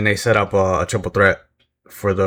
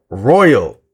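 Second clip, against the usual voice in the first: Royal blurted louder as the name pops up; sudden recall; 6 dB louder than usual. Real, not acted. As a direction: blurt Royal like it just came to you. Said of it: "There it is. It just popped in my head".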